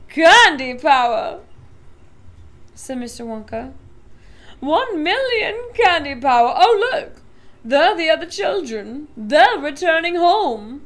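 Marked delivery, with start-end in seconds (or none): 0.1-1.4 louder, slow, savoring; proud announcement. A narrator's reading. Wonka proud answer. 4.6-10.8 loud, higher, bright pointing; excited Wonka. A narrator's reading.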